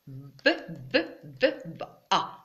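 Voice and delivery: short repeated b sound, then an open A; sounding out letters. Shown as a demonstration. Teacher showing children how B joins A, sounding it out. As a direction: sound out B piece by piece before the A. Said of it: "je prononce le B".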